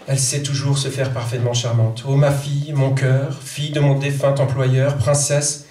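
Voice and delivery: measured French poetry reading. Acted. Translator reads her poem to the audience, measured poetry reading. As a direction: read each line with weight.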